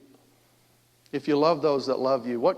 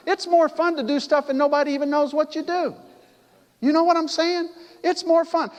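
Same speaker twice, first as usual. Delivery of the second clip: higher, louder, lively delivery; excited preaching; 12 semitones higher, 4 dB louder than usual. Real, not acted. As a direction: lift the congregation with joy. Preaching with rising energy.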